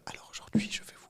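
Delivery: soft mock ASMR whisper, intro voice. Shown as a demonstration. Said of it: "parlent comme ça".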